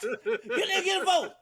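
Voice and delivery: high, laughing protest; outraged yell. Retold or imitated. Passenger protesting the vote through laughter, high outrage. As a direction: squeal it through laughter, indignant. Acted outraged reply in car.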